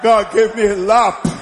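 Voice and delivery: rally the congregation, ending on a held hallelujah; full-force preaching. Real, not acted.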